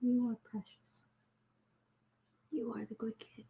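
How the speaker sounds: soft whispered cooing; whispering to a pet. Real, not acted. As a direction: hush and coo gently. Whispering to the kitten.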